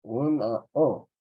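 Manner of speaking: una O drawn out slowly; slow emphasis. Shown as a demonstration. Teacher adds a vowel to a word, slow and stressed. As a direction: stretch una O for the class. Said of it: "le puedo aumentar".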